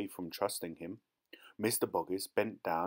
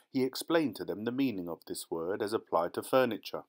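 ordinary delivery, nothing marked

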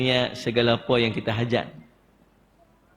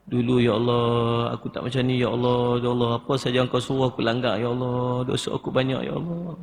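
pleading lament. Shown as a demonstration. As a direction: beg forgiveness, stretching each "ya Allah". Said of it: "Merayu, merintih dengan Allah".

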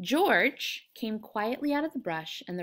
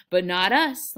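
loud, gleeful interjection; delighted, emphatic exclamation; happy cry; 9 dB louder, slower than usual. A narrator's reading. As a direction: burst in happily, much louder. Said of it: "Lenny cried happily".

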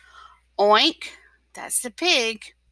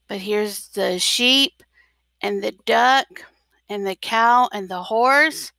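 plain reading voice

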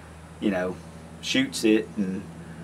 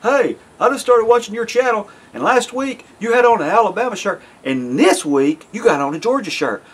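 much higher and louder, gotcha tone; new viewer calling him out in a high, loud mock accusing voice; 10 semitones higher, 4 dB louder than usual. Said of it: "calling me out and saying".

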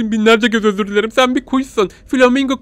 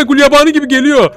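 scared; 8 semitones higher, 6 dB louder than usual. Real, not acted. Scared by monster.

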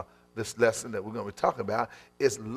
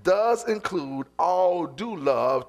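rising preaching; 6 semitones higher, much slower than usual. Acted. Preaching builds up.

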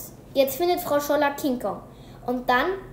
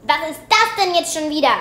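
loud, exasperated demand; louder, fed-up question; 4 semitones higher, 9 dB louder than usual. A narrator's reading. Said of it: "ruft Frau Scholler böse".

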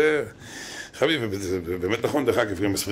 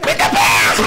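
imitated bellowing, far higher and louder; shouting; 6 dB louder than usual. Rabbi berating Liberman in his seat, imitated shouting. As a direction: yell it as a mock tirade. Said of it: "הוא צעק לו".

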